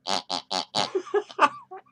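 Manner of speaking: deep, throaty clown cackle. Acted. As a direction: let out a low, creepy clown laugh. Clown cackle after squeaky toys.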